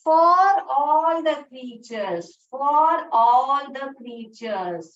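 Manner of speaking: slow and loud, word by word; slow emphatic recitation. Shown as a demonstration. Teacher leading children through the next line, slow and loud. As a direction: call it out slowly for the class to repeat. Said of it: "उसके बाद क्या line बोल रहे हैं".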